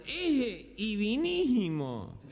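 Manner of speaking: drawn-out nasal "Ese y vinísimo"; imitated gangoso garble; gangoso speech impediment. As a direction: say it slowly through the nose, consonants missing. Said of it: "medio gangoso era".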